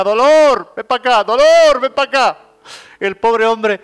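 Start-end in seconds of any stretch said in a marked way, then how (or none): 0.0-2.4 high, loud yell of a mother calling her son; a mother shouting for her child to come here. Retold or imitated. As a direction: holler across the house for the boy. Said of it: "cada vez que lo llamaba su madre decía".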